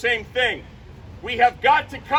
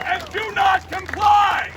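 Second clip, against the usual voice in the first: defiant parting shout; 6 semitones higher, slower than usual. Real, not acted. Candidate leaving the stage with a defiant shout. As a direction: fling the last line out as a battle cry.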